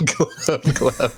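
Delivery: giggly gurgle noise; laughing through the glubs. Acted. Echoes the shark noise laughing.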